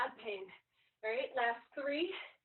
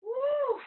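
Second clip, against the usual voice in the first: cheer; 12 semitones higher, 9 dB louder than usual. Real, not acted. Trainer cheering mid-workout.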